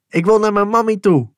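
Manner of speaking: put-on high, loud child's wail of "Ik wil naar mijn mammy toe"; child crying out. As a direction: cry "mammy" out loud and high like a desperate little boy. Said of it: "riep ik". (hoog en luid roepend kind)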